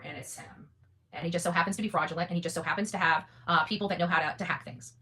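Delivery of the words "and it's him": hushed, pointed whisper. Real, not acted. Naming the man she's going after, whispered. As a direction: let it drop to a knowing whisper. Repeats line dropping to whisper.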